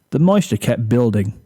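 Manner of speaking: ordinary delivery, nothing marked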